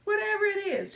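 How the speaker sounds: Whatever it is, burst out high and loud; urgent high outburst. Real, not acted. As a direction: push it out with sudden urgency. Urging viewers to release emotions.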